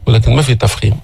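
normal talking voice

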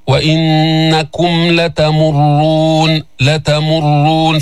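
slow recitation, لتمرون with thick held r; heavy doubled ra. Shown as a demonstration. Teacher models لتمرون, heavy ra with full doubling. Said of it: "فخم الراء وجيب الشد تعيتها".

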